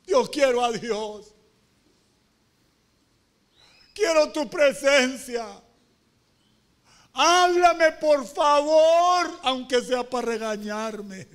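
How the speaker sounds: loud, high, drawn-out plea; acted prayer, voice rising to a wail then easing; crying out to God. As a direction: beg God at full voice, stretching the words. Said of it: "Van a andar llorando, andando y llorando".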